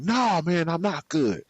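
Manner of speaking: high, agitated no; upset friend reply. Retold or imitated. Answering friend Rashard on the street, high upset. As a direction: let the anger spill out to a buddy. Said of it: "I am fuming".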